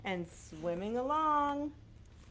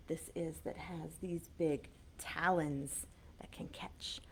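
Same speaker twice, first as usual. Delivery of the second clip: whisper; 5 semitones lower, 7 dB quieter than usual. Acted. Whispered closing.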